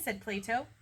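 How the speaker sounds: ordinary delivery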